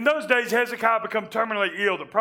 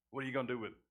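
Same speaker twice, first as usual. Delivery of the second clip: soft challenge; 8 semitones lower, 14 dB quieter, much faster than usual. Shown as a demonstration. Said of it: "question repeated three times".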